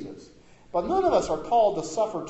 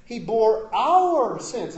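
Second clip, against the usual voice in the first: slow and louder, each word of "he bore our sins" pressed; emphatic; 6 semitones higher, 7 dB louder, much slower than usual. Shown as a demonstration. Pastor driving home "he bore our sins" to listeners, emphatic and louder. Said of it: "it's emphatic".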